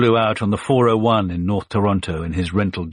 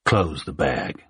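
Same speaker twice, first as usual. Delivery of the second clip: Mississippi drawl; 4 semitones lower, slower than usual. A narrator's reading. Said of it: "slow Mississippi drawl".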